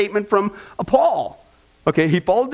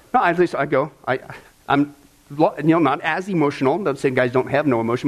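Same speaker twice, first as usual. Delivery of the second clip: ordinary talk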